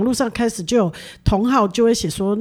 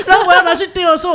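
higher and faster, laughing through it; 8 semitones higher, 4 dB louder, faster than usual. Real, not acted. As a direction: rush the words out while laughing. Funny glue story. (笑着快速说)

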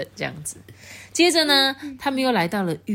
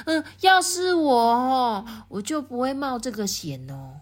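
loud reply; 7 semitones higher, slower than usual. Acted. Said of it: "大声的回答说".

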